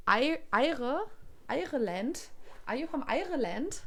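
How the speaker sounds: faltering Irish accent attempt, rising; trying an Irish accent. Shown as a demonstration. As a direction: fumble toward an Irish accent. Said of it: "How would you say it?".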